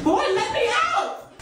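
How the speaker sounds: high, slow pleading yell; desperate yelling. Acted. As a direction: beg to be let out. Locked in restroom.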